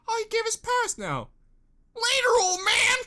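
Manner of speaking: high, loud mock farewell; squeaky bratty brush-off; mocking sisters. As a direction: toss off a snotty goodbye in a high voice.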